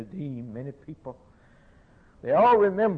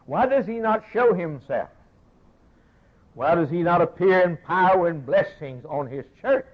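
raised, pleading pulpit cry; fervent preaching; 6 semitones higher, 6 dB louder than usual. Acted. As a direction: thunder it from the pulpit, higher and louder. Preaching at full force.

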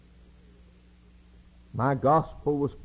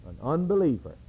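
normal talking voice

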